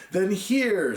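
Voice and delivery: a sudden cry of discovery. A narrator's reading. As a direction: burst out with it. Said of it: "said I with a sudden cry".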